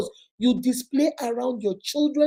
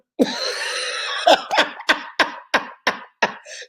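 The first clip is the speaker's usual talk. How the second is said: sudden high breathy laugh that falls away. Real, not acted. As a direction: burst of high airy laughter. Laughs after her own answer.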